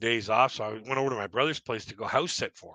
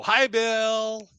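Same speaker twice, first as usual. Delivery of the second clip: high, drawn-out sing-song hello; mock cheery greeting; 9 semitones higher than usual. Joking about greeting the burglar in costume, mock cheery. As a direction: put on a silly, over-friendly high greeting.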